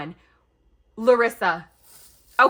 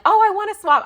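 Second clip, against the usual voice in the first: higher, louder mock-eager voice; playful raised quote of longing; eager wishing; 11 semitones higher, 9 dB louder, faster than usual. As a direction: act out an excited wish. Acts out longing to swap.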